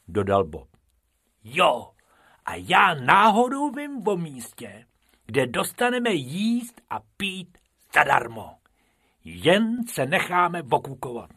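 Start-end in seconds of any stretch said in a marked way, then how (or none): 1.4-11.3 high, animated cartoon voice; high-pitched eager schemer; eager character voice. A narrator's reading. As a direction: pitch your bright idea excitedly, up high. Bobek eagerly pitches his zoo idea.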